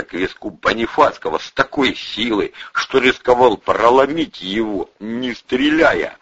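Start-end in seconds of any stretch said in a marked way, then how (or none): none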